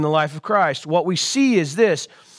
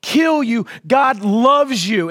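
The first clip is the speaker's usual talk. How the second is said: ordinary delivery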